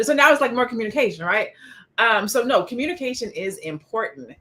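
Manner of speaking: ordinary talk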